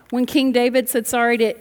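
ordinary talk

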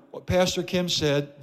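ordinary talk

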